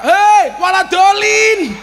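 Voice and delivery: high, slow, hammed-up yell. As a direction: bellow after the wandering pilgrim.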